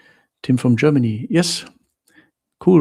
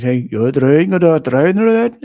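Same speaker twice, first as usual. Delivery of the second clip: loud, rising garbled Scottish mimicry; mock an incomprehensible Scottish accent, loudly; hammed-up Scottish babble climbing in pitch; imitated Scottish accent; 4 semitones higher, 8 dB louder than usual. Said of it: "the Scottish said".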